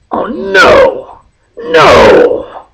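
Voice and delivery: high, loud cry of dismay; villain dismay. Acted. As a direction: wail at the ruined plan. Villain sees goblins escape.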